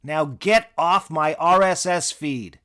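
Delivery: loud, high yell at the assistant; angry outburst. Acted. Comic yelling at his assistant to shut up, loud angry outburst. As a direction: blow up and shout him down.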